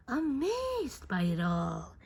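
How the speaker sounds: usual speaking voice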